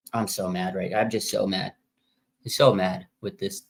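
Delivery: ordinary talk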